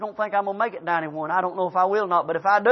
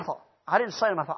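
ordinary talk

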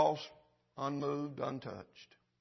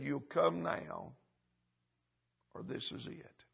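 slow, soft words fading to a whisper; slow whispered divine warning; hushed voice of God; 2 semitones higher, 6 dB quieter, slower than usual. Acted. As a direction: let God's warning drop to a breath. God's warning voiced in story.

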